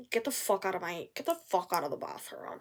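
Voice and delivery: soft whispered brush-off; whispered mock order. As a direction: hiss it out quietly. Quoting paramedics, whispered.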